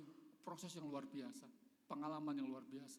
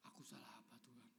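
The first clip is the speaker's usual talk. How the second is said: soft, lower whispered question to God; hushed prayer; 4 semitones lower, 14 dB quieter, faster than usual. Retold or imitated. Pastor retelling silent prayer after wife's anger, hushed. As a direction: ask God quietly, hurt.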